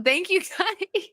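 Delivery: laughing through a thank you. Real, not acted. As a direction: giggle your way through it. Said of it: "I'm not laughing".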